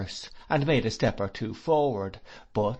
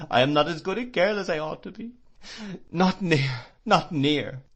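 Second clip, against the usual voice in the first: high, loud, fast sobbing; 7 semitones higher, 4 dB louder than usual. Acted. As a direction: blurt it out through sobs. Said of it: "sobbing, shaking her head".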